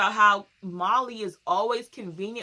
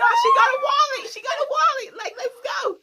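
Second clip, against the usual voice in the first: very high, loud frantic correction; hammed-up shouting over a commotion; mock panicked shout; 9 dB louder than usual. Bystander as the fight breaks up, mock panicked shout.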